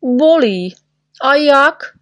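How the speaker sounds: high, emphatic confirmation; indignant, falling answer from a sore patient; pained complaint. Acted. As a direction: insist loudly that it really hurts. Hurt patient answers doctor's question.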